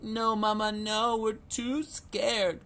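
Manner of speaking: very high, slow, small voice; a baby duck too frightened to move; tiny frightened duckling voice; scared duckling. A narrator's reading. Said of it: "cried Puddle and Little Quack".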